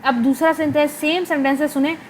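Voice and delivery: ordinary talk, nothing marked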